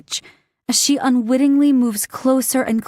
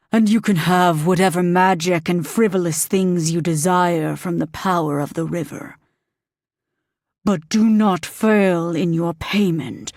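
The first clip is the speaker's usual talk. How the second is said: demon voice; 4 semitones lower than usual. Acted. Demon bargaining with Green, low and menacing. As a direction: speak as an ancient demon granting a bargain.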